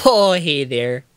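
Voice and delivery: long, slow Minnesota vowels; drawn-out folksy Midwest greeting; slow mock-Wisconsin accent. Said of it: "That is a very fun accent".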